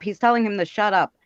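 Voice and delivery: ordinary talk, nothing marked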